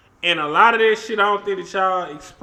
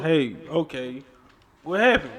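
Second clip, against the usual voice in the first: consoling; 3 semitones lower than usual. Shown as a demonstration. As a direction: show how to comfort an upset friend. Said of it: "console her".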